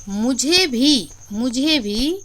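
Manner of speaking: मुझे भी slow and loud; teacher hammering मुझे भी; stress on मुझे भी. Shown as a demonstration. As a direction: drag out मुझे भी, me too. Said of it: "मुझ पर ये बल दे रहा है ना".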